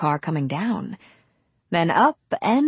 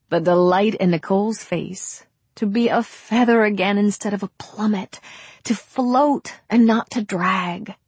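ordinary delivery

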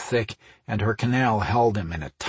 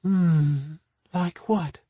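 sleepy voice; 7 semitones higher, much slower than usual. A narrator's reading. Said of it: "in a sleepy voice".

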